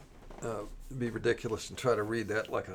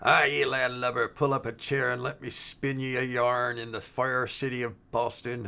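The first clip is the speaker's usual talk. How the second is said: put-on terrible pirate accent. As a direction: growl it out like a bad stage pirate accent. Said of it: "I do a terrible, terrible accent".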